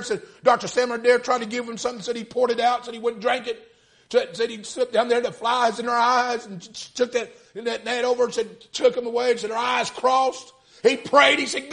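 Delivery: high, loud preaching cry; preaching at full force. Acted. Preacher retelling the story, full-force preaching.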